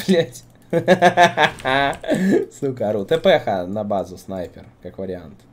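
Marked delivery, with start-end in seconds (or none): 0.7-2.6 loud, high helpless laugh; cracking up at a teammate; burst of laughter. Real, not acted.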